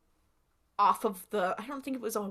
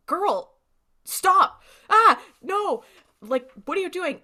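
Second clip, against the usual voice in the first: high, loud panicked cry of stop; yelling at her friend to stop, high and loud; 8 semitones higher, 8 dB louder than usual. Retold or imitated. Charlie on the phone begs Jill to stop, high loud panic.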